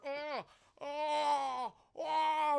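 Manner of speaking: high, drawn-out mock cries; Star Trek lurching groans. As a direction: groan as if thrown about a ship. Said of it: "They do the whole Star Trek".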